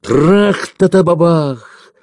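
high, slow, booming explosion noise; drawn-out dramatic artillery crash; imitated gun blast. A narrator's reading. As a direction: sound out a loud cannon burst. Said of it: "грохнул где-то совсем уж неподалёку".

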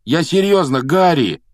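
high, loud entreaty; pleading. Acted. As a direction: beg the friend to listen. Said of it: "взмолился Рон".

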